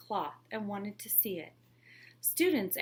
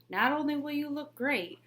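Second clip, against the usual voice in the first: higher, louder pitch; eager sales pitch; 7 semitones higher, 5 dB louder than usual. Acted. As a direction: sell it hard. Trickster sells.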